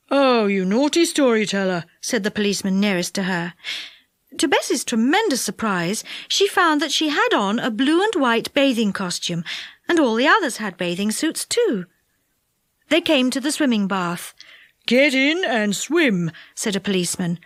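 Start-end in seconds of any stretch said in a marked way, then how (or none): 0.1-1.8 lightly voiced stern officer; policeman scolding. A narrator's reading. 14.9-16.3 slow, stern officer; a flat command; policeman ordering. A narrator's reading.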